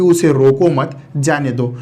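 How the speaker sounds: "उसे रोको मत" in one go, then "जाने दो"; pause after मत. Shown as a demonstration. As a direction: keep "रोको मत" joined, break before "जाने दो". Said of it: "सिर्फ इस कॉमा के कारण".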